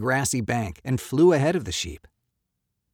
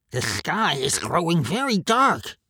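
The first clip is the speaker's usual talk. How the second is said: higher, worried animal voice; animal character voice; 6 semitones higher, 3 dB louder than usual. Acted. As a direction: report the darkening sky as an animal.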